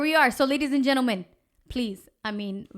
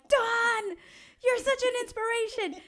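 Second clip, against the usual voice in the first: gushing praise; 13 semitones higher, 3 dB louder than usual. Retold or imitated. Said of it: "and I'm like".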